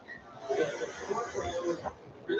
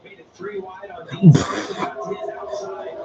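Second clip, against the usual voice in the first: excited play-by-play; 13 dB louder than usual. Real, not acted. Commentator calls a passing move.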